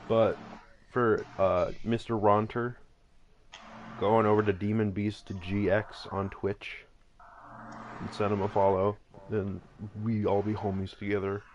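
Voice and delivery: ordinary delivery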